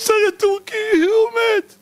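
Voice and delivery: high, hammed-up sobbing wail; mock crying moviegoer. Teary viewer of a Turkish melodrama, high mock sobbing. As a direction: wail over the hero like a melodrama fan.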